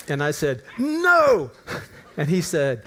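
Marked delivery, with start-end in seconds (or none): 0.8-1.5 loud, high, emphatic refusal; retold angry shout. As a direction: snap it back at the caller. Acting out his angry phone reply.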